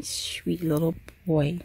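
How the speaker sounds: soft, slow coo to a frightened pet. Real, not acted. Mom comforting her trembling dog, soft slow coo. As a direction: murmur it like a lullaby.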